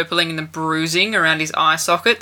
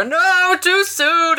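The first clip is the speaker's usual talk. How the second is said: high, wailed mock outcry; mock protest; 13 semitones higher, 3 dB louder, much slower than usual. Imitating half the wedding guests objecting, mock wailed protest. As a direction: wail it as a scandalised crowd would. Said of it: "half the people would go".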